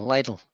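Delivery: "Lidl" starts like the word lie; LIE-dle. Shown as a demonstration. Spelling out store name for friends, lye-dle. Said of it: "L I is lie".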